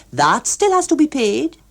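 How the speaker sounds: lightly voiced fussy official; emphatic official. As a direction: insist like a fussy tax man. Hughes Evans cuts in.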